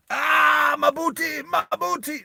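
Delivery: hammed-up squeal about a sore behind; mock wail. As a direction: ham up a comic yelp over the wound. [name] mock wailing over wound.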